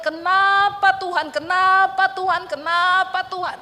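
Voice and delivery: loud, slow, high cry of why; anguished plea. Retold or imitated. Grieving widow crying out to God, anguished and loud. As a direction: hurl the question at God in grief.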